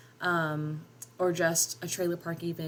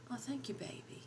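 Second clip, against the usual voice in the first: half-asleep whispered slur; slurred; 6 semitones higher, 13 dB quieter than usual. A narrator's reading. Groggy mother thanking her daughter, whispered slur. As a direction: slur it through drowsy lips, whispered. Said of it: "Mama slurred".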